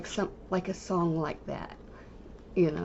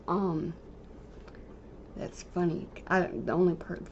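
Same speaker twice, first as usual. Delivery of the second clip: ordinary delivery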